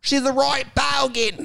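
high, slow Aussie bogan; mock Australian accent. Joining co-hosts' bogan riff, exaggerated Australian accent. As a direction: screech it slowly in broad Australian.

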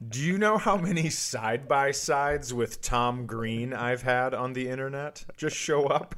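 ordinary talk, nothing marked

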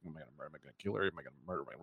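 low, quick murmur to himself; muttering the question under his breath, fast; a quick low murmur, wondering whether to kill her; hushed inner thought. Retold or imitated.

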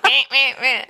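high, loud alien bleating; mocking blah blah. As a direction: bleat like a Mars Attacks alien. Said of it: "I'll just talk like that the whole time".

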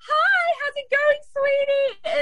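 high, drawn-out, gushing hello; bubbly greeting impression. Guest imitates how the host greets her, high gushing hello. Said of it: "every time we come up to you, like".

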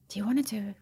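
half-whispered, tentative suggestion; hushed proposal. Retold or imitated. Husband floats a spa in America to wife, hushed. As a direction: float the idea softly, almost under the breath.

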